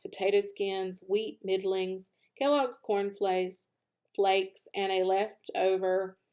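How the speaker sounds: usual speaking voice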